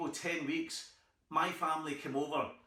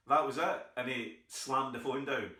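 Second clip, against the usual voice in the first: ordinary talk, nothing marked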